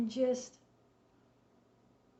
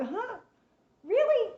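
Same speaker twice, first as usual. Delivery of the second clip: high, loud surprised "Really?"; startled disbelief; 10 semitones higher, 6 dB louder than usual. Retold or imitated. The girl at first hearing God's words, startled. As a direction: squeak it out in shock. Girl's first reaction, retold.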